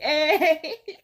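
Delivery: loud, high squeal of laughter. Real, not acted. Laughing at the date's price, high and loud.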